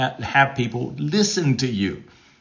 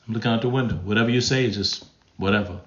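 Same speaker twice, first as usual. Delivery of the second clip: apathy; 2 semitones lower, 4 dB quieter, faster than usual. Acted. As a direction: toss it off, utterly uninterested.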